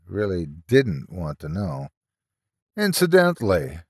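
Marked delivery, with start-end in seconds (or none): 2.8-3.8 dry mocking "Incidentally" with a long fall; sarcastic. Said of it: "asked Mark sarcastically".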